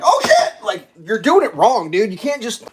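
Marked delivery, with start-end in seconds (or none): 0.0-0.6 sudden high, loud "Oh, shit!"; startled yelp. Retold or imitated. Driver startled by a car braking ahead. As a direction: yelp it in a fright. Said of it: "if I gotta fucking be like".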